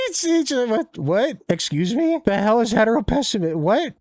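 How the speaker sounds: mock bafflement. Mock bewilderment at term.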